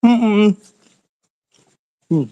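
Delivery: munching while humming; mouth full. Real, not acted. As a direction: hum through a mouthful of food. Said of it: "I'm munching".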